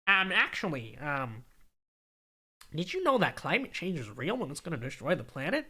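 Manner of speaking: nasal know-it-all nerd. Pretend. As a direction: play the nerdy know-it-all. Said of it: "I do sound like a nerd".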